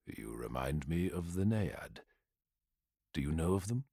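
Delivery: soft, low and tender; gentle awe. Acted. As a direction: speak gently, as if to something precious. Telemachon gently addressing the anamnesis.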